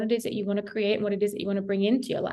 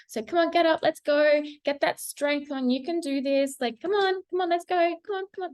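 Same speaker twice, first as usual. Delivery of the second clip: higher, rallying cheer; pep talk; 6 semitones higher than usual. Real, not acted. Tarot reader cheering viewers to get up. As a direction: pump them up like a coach. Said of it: "cheering viewers on".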